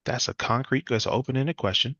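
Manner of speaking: usual speaking voice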